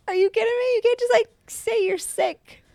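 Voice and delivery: high, loud disbelief; mock outrage. Mom scolding her daughter for faking sick. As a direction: act scandalized at the little trickster.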